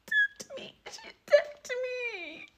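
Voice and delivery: very high, slow, whining sob; mock crying whine. Mocking the friend crying that she was touched. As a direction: whimper it like a tattling crybaby.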